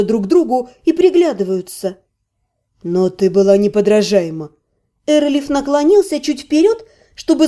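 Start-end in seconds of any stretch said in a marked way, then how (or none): 2.8-4.5 deeper, admiring man's voice; low male voice. A narrator's reading. Erelev speaks.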